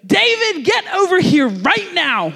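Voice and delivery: high, slow sharp call of a cross teacher; stern teacher summons. Retold or imitated. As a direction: call the kid over like an annoyed teacher.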